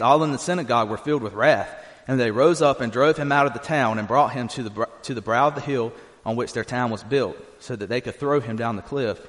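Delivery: usual speaking voice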